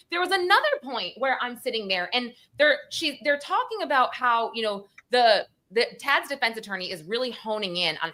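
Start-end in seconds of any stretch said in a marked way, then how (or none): none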